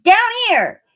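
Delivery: high, loud sneering invitation. A narrator's reading. Weasel luring the animals to the hole, sneering high and loud. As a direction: lure them with a sneer. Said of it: "he said with a sneer".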